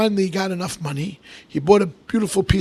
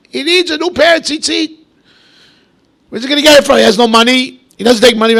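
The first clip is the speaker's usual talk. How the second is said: emphatic; 7 semitones higher, 9 dB louder than usual. Acted.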